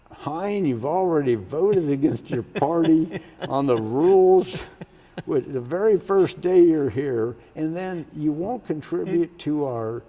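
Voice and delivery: loud, high-pitched retold scolding; Ross Doyen's angry dressing-down. Senate president has freshman senator against the wall, angry retold scolding. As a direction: act out chewing out a rookie. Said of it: "he was just ripping me up and down".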